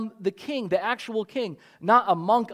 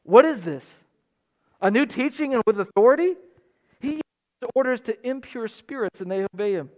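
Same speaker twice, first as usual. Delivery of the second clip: amazed crowd; 4 semitones higher, 3 dB louder than usual. Retold or imitated. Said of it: "what Mark has recorded people saying".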